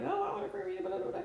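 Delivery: high, wheedling mock plea; whiny chat viewer. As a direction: mimic a pushy viewer begging. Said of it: "everybody starts like".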